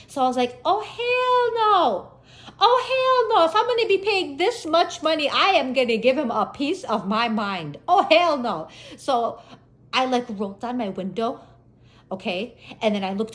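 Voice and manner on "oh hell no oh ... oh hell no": high, loud, drawn-out outrage; livid. Retold or imitated. Driver fuming over two costly tickets, livid outburst. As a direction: work yourself up in indignant refusal. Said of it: "I pretty much am livid".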